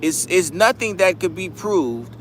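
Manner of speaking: usual speaking voice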